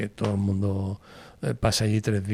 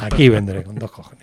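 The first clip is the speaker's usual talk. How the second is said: emphatic vow; 7 dB louder than usual. Real, not acted. Emphatic promise to radio host.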